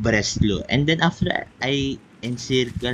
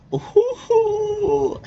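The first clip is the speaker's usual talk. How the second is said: a very high delighted cry; high excited cry; 3 dB louder than usual. Real, not acted. Gamer beating the German Reich, high joyful cry. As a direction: squeal with joy.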